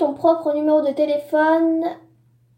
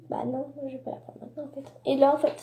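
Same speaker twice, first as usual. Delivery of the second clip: ordinary delivery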